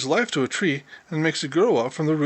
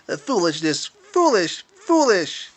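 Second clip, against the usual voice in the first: disapproving mutter; 2 semitones higher, 3 dB louder than usual. A narrator's reading. Mouse disapproves of Peter to himself. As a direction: shake your head at the folly. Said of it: "he said over and over to himself".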